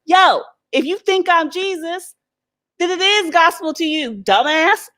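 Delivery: a drawn-out, high put-down; sassy comeback. Retold or imitated. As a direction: talk down to the fool slowly. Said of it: "And I'm like".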